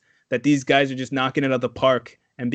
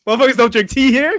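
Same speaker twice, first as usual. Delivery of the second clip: loud, higher-pitched mock outrage; indignant disbelief; 8 semitones higher, 7 dB louder than usual. Casting people reacting to the tea request, mock indignant disbelief. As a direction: protest it loudly, in mock disbelief.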